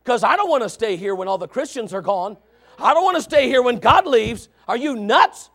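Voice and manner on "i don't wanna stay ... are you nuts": normal talking voice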